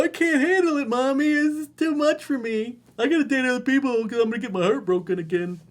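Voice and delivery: put-on high, loud whining kid voice; mock him as a helpless little boy crying for mommy; mock whiny crybaby. Mocking emotional guy.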